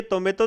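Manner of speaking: टोमेटो said as "तोमेतो", dental t; टोमेटो with ते sounds replacing टे; wrong soft T. Shown as a demonstration. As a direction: soften both Ts in टोमेटो against the teeth. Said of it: "ये टोमेटो तो नहीं है".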